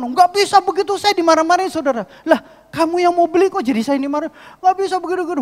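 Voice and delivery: very high, loud protesting tirade; hammed-up furious client refusing; shrieky mock rage, indignant and insistent; imitated anger. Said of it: "Marah, saudara".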